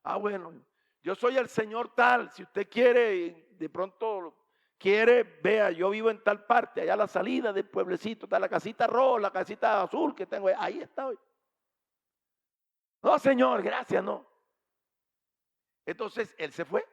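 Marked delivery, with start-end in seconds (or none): 0.0-11.2 insistent stranger. Retold or imitated. Stranger telling the wife where he lives, insistent. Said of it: "Le digo así". 13.0-14.2 high, loud polite refusal; firm refusal. Retold or imitated. Wife turning the stranger away, firm refusal.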